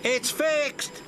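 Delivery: high excited call; mock cry of relief. A narrator's reading. Engineer announcing the signal is fixed, excited. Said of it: "a signal engineer cried out".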